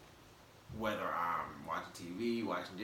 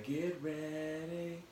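higher, slow drawn-out warning; drawn-out teasing warning; ominous warning; 7 semitones higher, 3 dB quieter, much slower than usual. Acted. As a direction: stretch it out like a playful threat. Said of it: "host hyping listeners".

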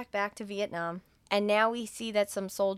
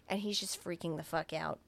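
normal talking voice

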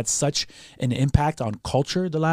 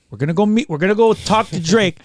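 excited; 7 semitones higher, 6 dB louder than usual. Retold or imitated. Excited dad telling kids.

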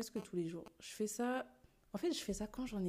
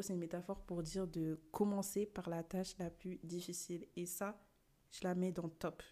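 normal talking voice

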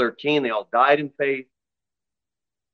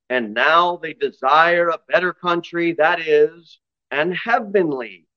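scripture reading; 5 dB louder than usual. Acted. Preacher reading Hebrews to the congregation, in a ringing voice.

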